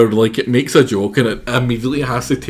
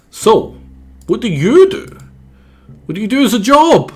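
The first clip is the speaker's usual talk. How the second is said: chirpy, much higher and louder character voice; 12 semitones higher, 7 dB louder than usual. Acted. Reading a character's question to the detective, high and chirpy. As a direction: pitch it up like a cartoon critter. Voicing game character dialogue.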